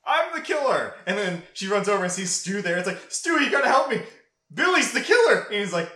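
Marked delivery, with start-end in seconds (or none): 0.1-0.9 gleeful reveal. Retold or imitated. Billy's triumphant reveal. 3.1-5.4 high, loud panicked yelling; begging Stu for help in a high panic; panicked plea. Retold or imitated.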